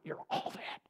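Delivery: whispered reassurance, deep in the heart. Acted. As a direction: breathe it like a secret. Holy Spirit speaking inside.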